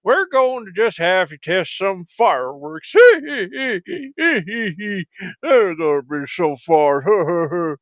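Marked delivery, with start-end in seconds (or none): 3.0-7.8 laughing through slow, drawn-out words; gleeful giggling. Acted. Tormentor planning a fireworks test, gleeful giggling. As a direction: giggle with mischievous relish.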